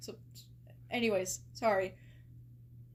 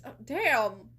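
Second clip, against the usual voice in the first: surprised; 8 dB louder than usual. Real, not acted. Reacting to Ralsei's threat.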